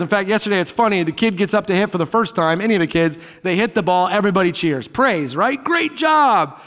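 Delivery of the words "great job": high-pitched crowd cheer, imitated. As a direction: cheer on a kid's hit, mock spectator. Crowd cheering a kid's hit.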